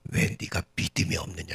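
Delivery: low, understated rebuke; acted reproach. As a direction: reprove without raising the voice. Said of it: "예수님 뭐라고 책망하시냐면". (낮은 목소리로 조용히 책망하듯)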